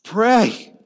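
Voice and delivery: a single loud, high word; loud command. Real, not acted. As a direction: call it out, ringing.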